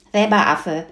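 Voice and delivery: put-on indignant girl's exclamation; calling out. A narrator's reading. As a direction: throw the insult straight back. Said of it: "rief Hütchen".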